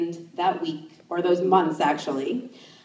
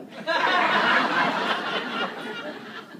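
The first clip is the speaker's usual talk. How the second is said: high laugh; 9 semitones higher than usual. Real, not acted. Laughs at own story.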